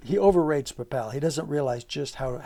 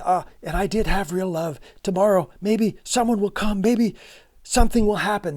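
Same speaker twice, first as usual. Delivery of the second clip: bright, louder and higher, full of hope; hopeful tone; 4 semitones higher, 5 dB louder than usual. Acted. Teacher reads Nastya's hopeful lines, louder and higher. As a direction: lift the voice with a glimmer of hope. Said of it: "listen to her, the tone of her language".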